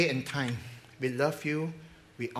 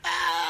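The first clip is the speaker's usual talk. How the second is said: imitated wail; 13 semitones higher than usual. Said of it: "He began to cry".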